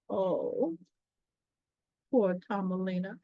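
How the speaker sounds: ordinary delivery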